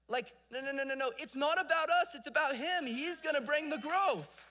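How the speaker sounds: high, loud preaching build; full-force preaching. Acted. Preacher to church, insisting the growth is God's, full-force preaching. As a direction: drive it home from the pulpit.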